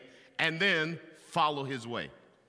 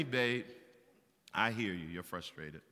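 soft, lower, soothing reply to her husband; calm wife; 9 semitones lower, 6 dB quieter than usual. Retold or imitated. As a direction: gently talk him down. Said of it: "my wife will say to me".

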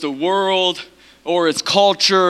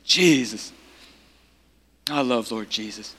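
low, soft adoration; tender devotion; 5 semitones lower, 5 dB quieter than usual. Real, not acted. Preacher to congregation, loving Lord Jesus, tender devotion. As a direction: breathe out love for Jesus, quietly.

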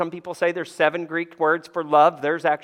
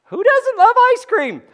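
mock outrage; 7 dB louder than usual. Real, not acted. Playful aside to congregation.

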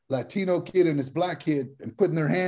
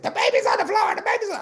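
high, loud frantic shriek of a panicked mother; panicked shout; 3 dB louder, faster than usual. Retold or imitated. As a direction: shriek it like the baby just hit the floor. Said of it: "her mama just lost it".

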